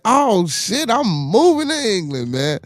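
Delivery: high-pitched mock elation; excited. Told his accent isn't bad, high excited boast. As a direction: exclaim it high, thrilled by the compliment.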